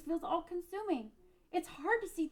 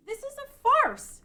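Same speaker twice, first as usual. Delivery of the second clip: indignant outburst; 8 semitones higher, 8 dB louder than usual. Acted. Refusing the vision.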